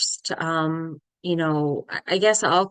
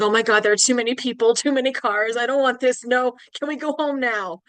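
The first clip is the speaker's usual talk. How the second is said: higher-pitched, panicky protest; imitated overwhelmed pleading; mock freak-out; 6 semitones higher, 4 dB louder than usual. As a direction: retell his rising panic, pitch climbing. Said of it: "Scott starts freaking out".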